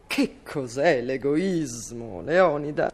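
slow, low, solemn reply. Acted. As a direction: weigh each word gravely.

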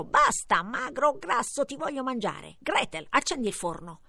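a moderately voiced witch shouting at Gretel. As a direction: snap the order like a hungry witch. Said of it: "la strega gridò".

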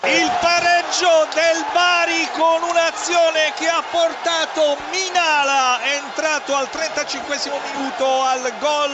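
breathless italian goal commentary. Real, not acted. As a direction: burst in shouting the equaliser. Equaliser called live. (annuncio del gol urlato)

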